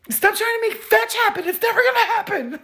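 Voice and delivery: put-on high, mean-girl quote voice; movie quote voice. Quoting the movie to her viewers, mean-girl voice. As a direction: sneer it like the movie line.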